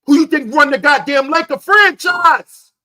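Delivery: real exasperated shout; shouted question. Real, not acted. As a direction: holler it at the listeners. Ranting loudly at his viewers.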